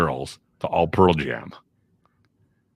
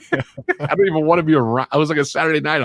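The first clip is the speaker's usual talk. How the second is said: high, laughing through the words; real laughter breaking through a story; 11 semitones higher, 5 dB louder than usual. Real, not acted. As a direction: chuckle out the complaint. Laughing at his own misery.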